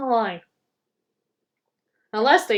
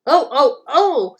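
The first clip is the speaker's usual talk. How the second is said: high, loud shocked gasps; high indignant oh oh oh; shocked trucks; 3 semitones higher, 8 dB louder, slower than usual. Acted. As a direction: squeal out the trucks' alarm. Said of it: "laughing rudely".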